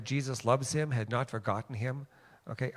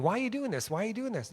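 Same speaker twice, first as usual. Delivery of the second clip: high, nagging repeated question; imitated repeated asking; 8 semitones higher, faster than usual. Man who kept asking the donut giver why, imitated nagging. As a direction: mimic someone pestering for an answer. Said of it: "he just kept asking".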